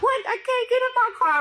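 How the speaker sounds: high, tearful plea; crying. Retold or imitated. To the security guard, tearful and high. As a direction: wail it like you're about to sob. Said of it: "I'm crying".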